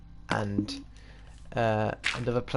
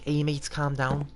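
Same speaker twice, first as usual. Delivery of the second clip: put-on higher-pitched, poor Liverpool accent; attempted Scouse accent; 4 semitones higher, slower than usual. Liverpudlian telling mates to calm down, attempted Scouse accent. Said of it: "I really can't do it".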